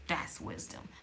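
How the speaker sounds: breathy hushed emphasis; whispered. Acted. Vlogger whispering the lesson to viewers. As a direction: whisper it, drawn in close. Repeating the moral.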